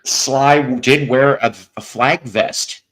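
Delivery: usual speaking voice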